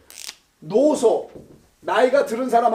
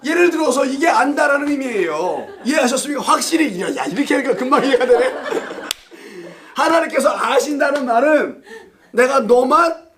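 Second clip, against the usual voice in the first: laughing; 5 semitones higher, 3 dB louder than usual. Real, not acted. Preacher laughing at own joke.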